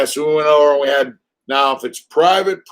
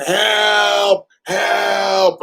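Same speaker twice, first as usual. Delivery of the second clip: higher pitched mock cries of help; mock yell for help; 7 semitones higher than usual. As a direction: imitate his yells for help, lightly. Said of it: "he starts yelling".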